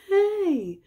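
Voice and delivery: high, loud mock-offended call; indignant shout. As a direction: call out in high-pitched protest. Voices woman objecting in film.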